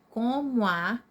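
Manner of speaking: COMO A merged as co-mua; run together. Shown as a demonstration. Teacher showing the joined sound, COMO A fused into co-mua. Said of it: "eu juntei".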